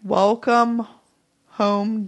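ordinary delivery

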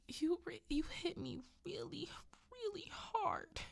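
very high, put-on wounded whimper; milk the injury like a pitiful child; wheedling hurt husband. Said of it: "He says it just like that".

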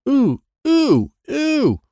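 high, loud, eager ooh ooh; excited ooh. A narrator's reading. Boy eagerly pointing out the screw, excited ooh. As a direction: squeal to get attention. Troy urgently signalling.

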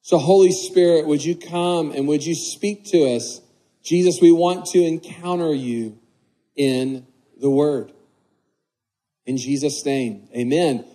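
prayer. Acted. Leads the congregation in prayer.

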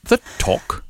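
high, whispered blank echo of the name; a stranger whispering "The Talk" back, puzzled and high; baffled local echo. Retold or imitated. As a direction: repeat "The Talk" back as if you've never heard of it. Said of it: "and he said".

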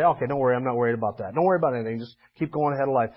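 low, soft, offhand reading; casual brush-off. Acted. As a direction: toss it off as if it hardly matters. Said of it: "does not want us to just simply read it".

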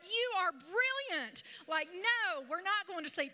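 high, emphatic gushing exclamation; amazed delight. Retold or imitated. Grandma to clever toddler refusing sleep, amazed delight. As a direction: marvel at the child, high and insistent.

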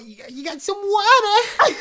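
high, loud laughing squeal; excited laughing squeal. Real, not acted. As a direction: squeal with delight through laughter. Host overexcited at Boston news.

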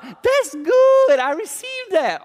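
high, bright mock voice of an eager listener; mock willing listener. Preacher voicing an eager churchgoer, high and mock-cheerful. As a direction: squeak it out like a keen churchgoer.

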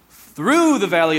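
"through" hit loud and drawn out; stress on THROUGH. Shown as a demonstration. Preacher quoting the Psalm, heavy stress on "through". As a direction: lean long and hard on "through", then drop.

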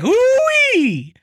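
high, loud "Ooh-wee!"; Okie cowboy holler. Retold or imitated. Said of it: "he says things like".

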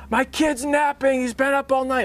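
screaming parent. Retold or imitated. Homeowner screaming at the door-knocker, high mock yell. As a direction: imitate the furious neighbor yelling. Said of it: "I had someone screaming at me".

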